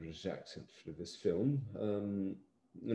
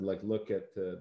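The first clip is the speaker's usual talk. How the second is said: normal talking voice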